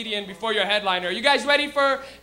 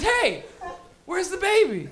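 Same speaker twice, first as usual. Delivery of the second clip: higher-pitched puzzled call; mild mock concern; 5 semitones higher, slower than usual. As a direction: act out someone looking for a baby. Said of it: "your first reaction is".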